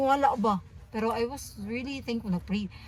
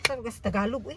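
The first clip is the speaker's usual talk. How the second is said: ordinary delivery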